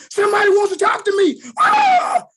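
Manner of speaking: put-on high, loud, fast boyish shout of joy; excited shout. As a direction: burst out like a thrilled kid. Child excited seeing comic.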